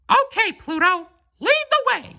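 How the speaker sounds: very high, cheerful cartoon mouse; Mickey Mouse agreeing to follow his dog; Mickey's voice. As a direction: chirp it high and ready to go.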